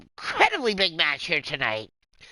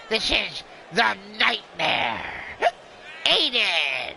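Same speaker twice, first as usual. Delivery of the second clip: slow drawn-out ring announcer intro; ring announcer call; much slower than usual. Acted. Announcer calling the wrestler into the arena, slow and drawn out. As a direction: stretch the name out like a big arena announcer.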